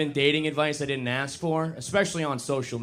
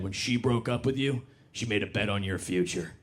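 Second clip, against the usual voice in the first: grim motivational voice; 4 semitones lower than usual. Retold or imitated. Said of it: "posted an Instagram that said".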